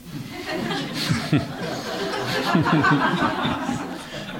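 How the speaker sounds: loud breathy burst of laughter; big laugh. Acted. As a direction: laugh hard at your own joke. Nervous joke on plane.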